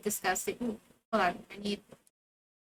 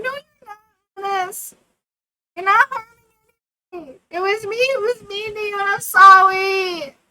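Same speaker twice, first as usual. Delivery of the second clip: much higher, louder, sung-out sorry; hammed-up apology; 8 semitones higher, 11 dB louder than usual. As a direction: ham up the apology. Acting out her character's apology.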